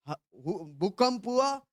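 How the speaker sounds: ordinary delivery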